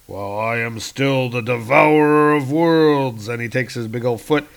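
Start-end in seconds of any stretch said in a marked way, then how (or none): 0.1-3.3 loud, booming villain's boast; menacing Galactus. Acted. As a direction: boast grandly as a cosmic giant. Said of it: "says Galactus".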